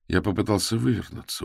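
usual speaking voice

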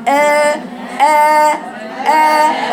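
آ said with a moderately open a; open hamza. Shown as a demonstration. Teacher demonstrating the open hamza to students, clear. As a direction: voice the open alif short and clear. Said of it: "الحرف المفتوح كده".

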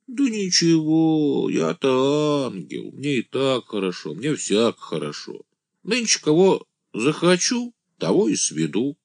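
высокий протяжный голос юродивого; тяни слова высоко, беспечно хвастаясь; блаженный «ангел», хвастливо. A narrator's reading. (юродивый, нараспев)

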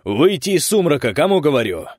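loud high stern shout; menacing demand. Acted. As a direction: threaten her. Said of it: "грозно потребовал дядя Миша".

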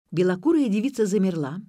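usual speaking voice

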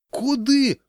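high-pitched startled yelp; alarmed. A narrator's reading. Crowd recoiling from the order, alarmed cry. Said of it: "шарахнулась толпа".

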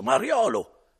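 put-on angry drunk shout. Drunk Renzo berating innkeeper.

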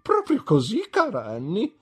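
high, loud gushing, peaking on "testamento"; theatrical dowager relishing the news; excited old lady. A narrator's reading. Mother gushes to the guests about the will. Mrs McLean voiced.

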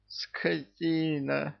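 seething, stretched-out hiss; hissed curse. A narrator's reading. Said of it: "прошипел он".

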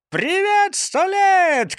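high, loud cheery hello; cheerful shout. A narrator's reading. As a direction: call out gaily to the crowd. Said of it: "крикнул он весело".

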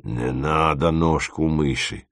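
low, soft, slow plea; timid request. A narrator's reading. Dima begs off his friend's recipe, timid. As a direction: plead meekly and slowly. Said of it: "попросил Дима".

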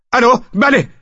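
high, loud yell into the phone. Acted. Frantic husband answers unknown caller, yelling. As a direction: answer far too loudly, pitch jumping up.

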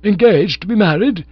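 loud, high shocked outburst. A narrator's reading. Bingo hearing his love is engaged, loud shocked cry. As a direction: cry out in disbelief.

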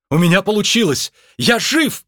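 high, excited cry of triumph; joyful exclamation. Acted. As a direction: exclaim it high, as if amazed to be alive. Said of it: "воскликнул он". (радостный возглас)

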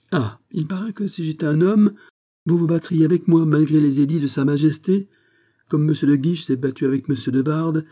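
high, heated accusation; exasperated challenge. A narrator's reading. Princess accusing the courtier, in exasperation.

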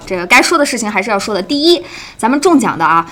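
ordinary talk, nothing marked

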